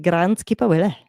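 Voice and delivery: a light Scottish lilt on the name; Scottish accent. Acted. Commentator savoring a word they like saying, Scottish accent. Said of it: "word I can say in a Scottish accent".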